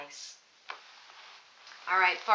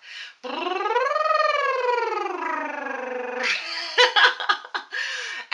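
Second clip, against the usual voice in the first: big high laugh that tumbles down; demo belly laugh; 13 semitones higher, 5 dB louder than usual. Shown as a demonstration. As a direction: laugh freely from the belly to show the exercise. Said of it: "laughter is another great exercise".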